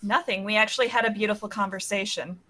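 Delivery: deadpan sarcasm; very monotone. Acted. Character asked what they talked about, monotone sarcasm. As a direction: deliver it evenly with no lift at all. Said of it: "very monotoned".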